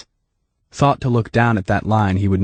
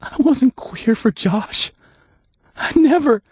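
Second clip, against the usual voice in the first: slow, higher, cracking denial; voice breaking; slower than usual. A narrator's reading. Dave denying the charge, voice breaking. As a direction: let the voice break as you deny. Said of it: "Dave's voice was breaking".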